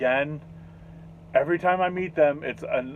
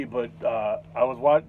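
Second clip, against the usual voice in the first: ordinary talk, nothing marked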